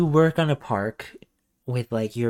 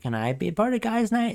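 much higher, hopeful little voice; lightly voiced eager old gent; Pops asking; 10 semitones higher than usual. Pops asking the guys to join, high and hopeful. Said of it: "Pops shows up and he's like".

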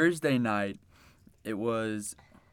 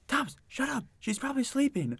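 higher mocked voice of a friend hushing him; imitating Brian; 12 semitones higher, 4 dB quieter, faster than usual. Brian telling Thomas to be quiet, mock voice. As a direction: hush him in a put-on higher voice. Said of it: "And then Brian goes".

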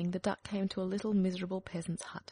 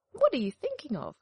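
high, loud, indignant hen; indignant hen cry; 8 semitones higher, 5 dB louder than usual. Acted. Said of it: "cried the hen".

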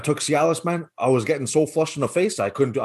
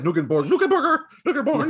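higher, laughing mock back-and-forth; imitated name-correcting bicker; 13 semitones higher than usual. Host retelling the film's name correction, imitated bickering. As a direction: play both sides of the name squabble lightly. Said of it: "yeah, he's like".

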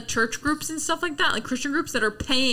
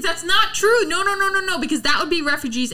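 heated high raised voice; shouting; 9 semitones higher, 3 dB louder than usual. Real, not acted. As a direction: shout the denial. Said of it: "I was screaming".

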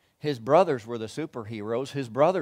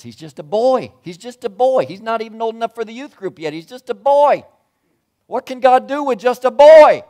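higher and louder to a full shout; rising preaching shout; 11 semitones higher, 14 dB louder than usual. Real, not acted. Preacher to congregation, building to a shout. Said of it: "preacher building to shout".

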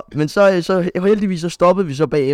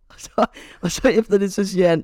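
usual speaking voice

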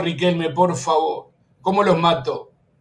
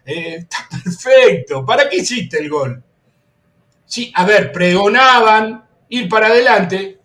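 usual speaking voice

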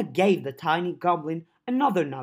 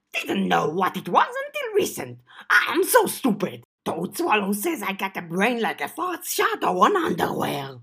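small excitable goblin voice; chirpy little goblin creature; 4 semitones higher, 4 dB louder than usual. Acted. Goblin Cherry voiced in story.

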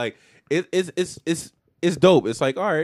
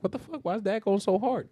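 incredulous; 12 semitones higher than usual. Retold or imitated. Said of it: "And you're like".

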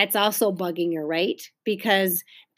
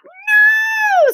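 very high, loud shriek; piercing high yell; screamed refusal; 8 dB louder than usual. A narrator's reading. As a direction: scream it out like a small child. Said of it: "screamed Ramona".

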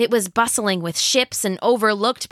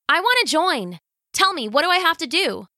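put-on higher, fast and eager girl; eager teenage girl; 9 semitones higher, faster than usual. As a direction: gush like a thrilled teen. Eager teen heroine.

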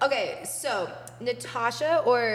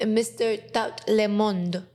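slow put-on French accent on Tat Le Monde; playful fake French; mock French; slower than usual. Shown as a demonstration. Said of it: "let me get more French with it".

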